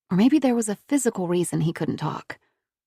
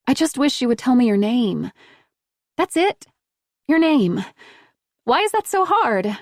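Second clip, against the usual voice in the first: pleading; 6 semitones higher, 4 dB louder than usual. A narrator's reading. Pleading with silent boy.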